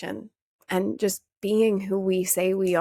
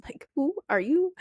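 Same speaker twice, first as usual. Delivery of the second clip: curious wonder; 6 semitones higher than usual. Retold or imitated. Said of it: "I'm like".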